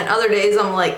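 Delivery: ordinary delivery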